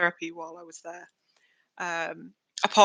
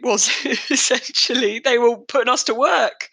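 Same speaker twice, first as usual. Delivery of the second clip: laughing; 4 semitones higher, 4 dB louder than usual. Real, not acted. Joking about slave labour.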